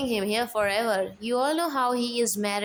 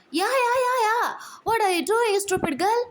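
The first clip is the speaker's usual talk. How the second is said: much higher, louder shrieking yell; husband yells at his wife, furious; 10 semitones higher, 3 dB louder than usual. Acted. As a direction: shriek at her over the perfume.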